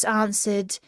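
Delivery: usual speaking voice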